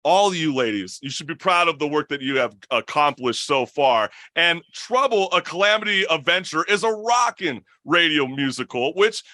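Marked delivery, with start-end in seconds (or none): none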